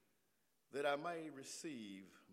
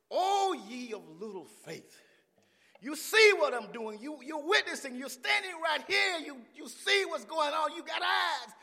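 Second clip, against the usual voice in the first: loud, raised preacher's exhortation; preached rebuke of followers; 12 semitones higher, 15 dB louder than usual. Acted. Jesus chiding his followers for little faith, preached forcefully. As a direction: build to a high, forceful reproach. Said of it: "Even Jesus told his own followers".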